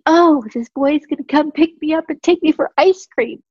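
high, giddy imitation of an excited teenage girl. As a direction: gush it like a thrilled teen daughter. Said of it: "so giddy".

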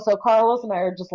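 usual speaking voice

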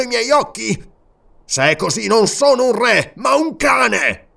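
grief turning to fury; grieving rage. A narrator's reading. King to servant, anguished then enraged.

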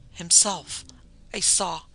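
halting, understated horror; choked. A narrator's reading. Terrified wife tries to name what she saw, choking. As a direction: break off as words fail. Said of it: "she choked".

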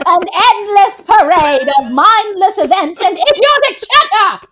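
put-on grand, theatrical old-woman voiceover, swelling as it goes; old Rose. Co-host doing the film's voiceover on request, hammed-up old Rose. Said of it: "[name], do the voice".

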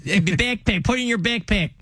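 comic Chicago cop impression; Chicago accent. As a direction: flatten BACKPACK the Chicago way. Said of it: "no better word to say in a Chicago accent than backpack".

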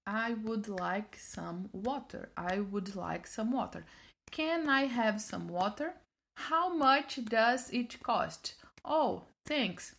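slow, deliberate learner English; carefully practised phrasebook lines; careful rehearsal. Retold or imitated. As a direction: recite each phrase word by word. Rehearsing an English script in her head.

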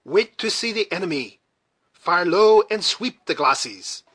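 high military command, slowing at the end. A narrator's reading. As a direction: call the orders down to the troops. Commander orders his troops.

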